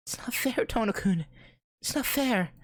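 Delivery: put-on high-pitched whining girl's voice; spoiled child. A narrator's reading. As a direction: protest like an upset child, pitched far up. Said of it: "she shouts like a spoiled child".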